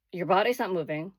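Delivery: usual speaking voice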